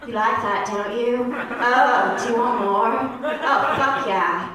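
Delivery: hammed-up British dirty talk; bad British accent. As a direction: do a badly faked British accent, slow and overblown. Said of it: "in a British accent".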